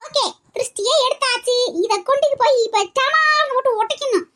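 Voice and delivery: high-pitched, bossy granny voice; bossy old woman. Acted. Grandmother insisting to the kids, high and bossy. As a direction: play the know-it-all elder woman.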